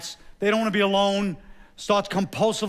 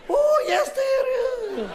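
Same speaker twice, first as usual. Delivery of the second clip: crazed Mary Queen of Scots; much slower than usual. Acted. Comic queen shrieking from the north in high falsetto. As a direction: squeal it like a crazed Mrs Doubtfire.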